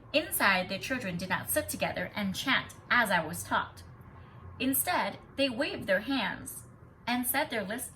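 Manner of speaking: expressive read-aloud voice; story narration. A narrator's reading. Teacher reading a picture book to students, expressive. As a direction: read the story to the class, bringing each line to life.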